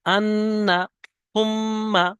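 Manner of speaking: আন্না and সুম্মা with the doubled ন and ম held in the nose; nasal hold. Shown as a demonstration. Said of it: "আওয়াজটা অতক্ষণ নাকে চেপে রাখতে হবে".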